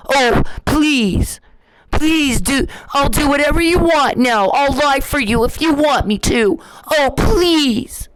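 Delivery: high, whiny mocking plea; mock begging. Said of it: "make me call you and beg".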